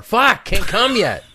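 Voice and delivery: laughing through the words; words breaking into a laugh. Real, not acted. Laughing while speaking.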